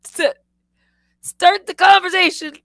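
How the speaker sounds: high, loud commanding grandpa voice; mock grandpa ordering her to talk; bossy grandpa. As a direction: bark it like an impatient old man. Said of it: "He would say".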